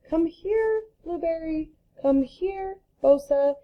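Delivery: high sing-song call to pets; calling the dogs. Retold or imitated. Teacher imitating calling her dogs Blueberry and Bosa, high and bright. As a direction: call them like your dogs are across the yard. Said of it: "when I'm talking to my dogs".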